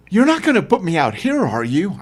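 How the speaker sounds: high, pleading woman's voice on the edge of panic; near panic. Acted. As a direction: beg him not to leave her. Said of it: "near panic in her voice".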